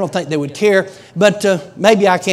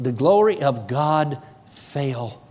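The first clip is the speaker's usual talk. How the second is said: very slow, lowered and soft; awed testimony; 5 semitones lower, 4 dB quieter, much slower than usual. Retold or imitated. Preacher imitating instructor's account of glory falling, slow and hushed. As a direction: let each word fall slowly, with weight. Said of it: "this is the way she said it".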